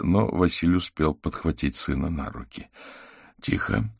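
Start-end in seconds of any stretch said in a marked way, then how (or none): 3.4-3.9 low, contained order; restrained command. Acted. Master commands his growling div, low restrained order. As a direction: quietly order him to settle, pitch dropped. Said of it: "скомандовал Аверин Диву".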